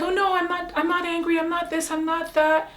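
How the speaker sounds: high, running string of denials; defensive protest. Retold or imitated. Herself before, denying she was angry, defensive.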